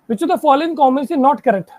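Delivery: slow, measured clue reading. Shown as a demonstration. Teacher dictating the next clue to the class, slowly. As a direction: read each word out carefully. Reads the question aloud.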